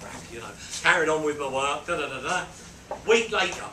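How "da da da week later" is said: ordinary delivery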